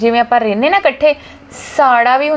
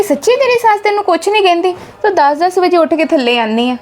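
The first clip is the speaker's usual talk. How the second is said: mom amazed; 7 semitones higher than usual. Retold or imitated. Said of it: "ਉਹ ਮੈਨੂੰ ਪੁੱਛਣ ਲੱਗ ਪਏ".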